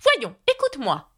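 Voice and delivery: high-pitched call for attention; impatient cry. A narrator's reading. Red Queen demanding that Alice listen, high exclaiming voice. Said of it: "s'écria la Reine Rouge".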